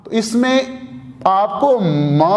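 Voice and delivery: ordinary talk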